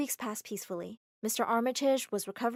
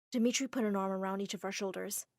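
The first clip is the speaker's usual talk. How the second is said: ordinary delivery, nothing marked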